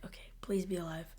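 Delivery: hushed, whispered plea; anxious whisper. Retold or imitated. Monk whispering to the still grandma, anxious plea. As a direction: whisper it nervously hoping.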